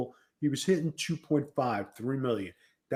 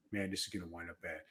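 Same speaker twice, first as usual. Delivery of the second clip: worried mutter; 7 semitones lower, 15 dB quieter, faster than usual. Retold or imitated. Retold worried thought aside.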